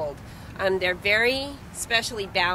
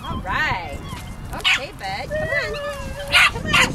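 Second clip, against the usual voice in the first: excited dog praise; 6 dB louder than usual. Real, not acted. Calling the dog to retrieve.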